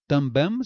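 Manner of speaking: TAMBÉM loud, ending in a consonant m; wrong pronounced M. Shown as a demonstration. Teacher showing the wrong way, TAMBÉM with sounded m. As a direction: punch out também with lips shut on a released m. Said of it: "se eu falasse também".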